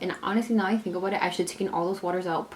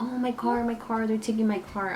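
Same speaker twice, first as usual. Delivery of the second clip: put-on higher, whiny little-kid voice; mock toddler whine, pitched up; imitating whining toddler; 4 semitones higher than usual. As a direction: whimper it like a small child losing her car.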